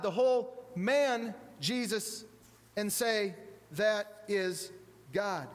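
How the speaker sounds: the whole man Jesus, spaced out word by word; slow it right down, pausing before "God"; slow, deliberate emphasis. Acted. Preacher repeats Luther's words to the congregation, slow and deliberate.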